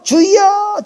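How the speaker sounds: high, long-drawn "Lord!"; mock prayer cry. Mimics believers crying "Lord!" thrice.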